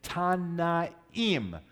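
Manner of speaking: "Tanaim" drawn out syllable by syllable, Ta-na-EEM; careful pronunciation. Shown as a demonstration. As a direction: model "Tanaim" slowly for learners, ending on a clear "eem". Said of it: "this is a little harder to say".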